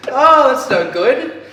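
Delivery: high, loud talk through real laughter; laughing. Real, not acted. Podcaster admitting the forgotten premise, laughing. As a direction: laugh helplessly at your own slip.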